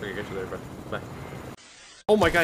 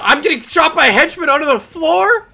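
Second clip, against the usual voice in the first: loud, high shocked shout; shocked yell; 10 semitones higher, 9 dB louder than usual. Real, not acted. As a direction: yell in disbelief. Shot by hidden henchman.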